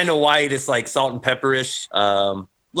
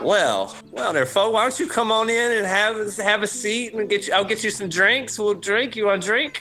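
put-on old shopkeeper; 10 semitones higher than usual. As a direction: play a friendly toothless junk dealer. GM voicing the shopkeeper.

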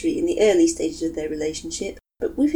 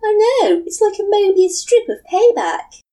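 playful laughing; 10 dB louder than usual. Acted. Matsuri joking.

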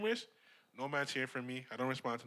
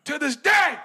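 big, high, loud shout of TO THIS DAY; shouted hype; 12 semitones higher, 17 dB louder, slower than usual. Acted. Shouting to the crew in a hyped call-out. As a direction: belt it out like a hype man.

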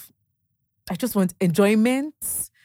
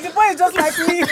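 high, louder, laughing through the words; laughing remark; 11 semitones higher, 6 dB louder than usual. Retold or imitated. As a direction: laugh proudly at the son. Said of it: "I'm like".

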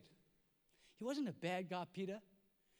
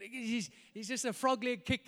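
normal talking voice

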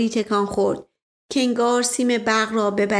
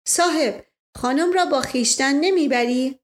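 put-on Indian-accented Persian; 4 semitones higher than usual. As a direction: play the polite Indian sardar, a touch of Indian accent. Indian man asks again.

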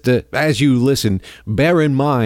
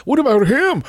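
heated retort; 13 semitones higher than usual. Real, not acted. Host's own heated retort.

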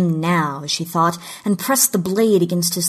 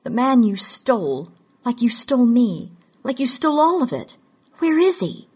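accusation climbing steadily higher in pitch; heated demand; 6 semitones higher than usual. A narrator's reading. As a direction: build from accusation to an urgent high demand. Captive demands the stolen man back.

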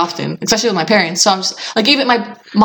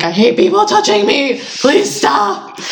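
whiny plea; 5 semitones higher, slower than usual. Retold or imitated. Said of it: "I'm like".

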